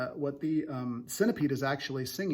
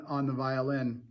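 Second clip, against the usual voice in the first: ordinary talk, nothing marked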